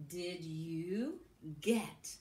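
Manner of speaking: ordinary delivery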